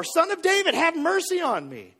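high, urgent retold cry; imitated insistent shout. Blind man shouts over those hushing him, high insistent shout. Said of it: "he shouted all the more".

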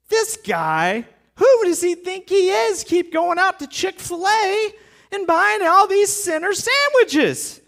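high-pitched mock outrage at a guest; indignant grumbling. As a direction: complain in a high, scandalized religious voice. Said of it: "main things that they muttered about".

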